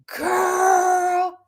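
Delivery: one long scandalized wail. Real, not acted. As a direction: stretch it out high in shock. Reacting to scandalous funeral comment.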